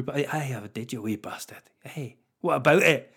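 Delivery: lightly voiced defiant Scottish retort; a hint of a cocky Scottish comeback; super Scottish. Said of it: "she'll just go super Scottish".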